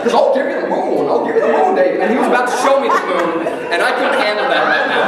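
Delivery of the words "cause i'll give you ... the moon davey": Jimmy Stewart impression. The drunk patron offering Davey the moon, high, loud and stammering. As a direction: beg to lasso the moon, stammering and rising. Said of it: "He goes by Mister Stewart".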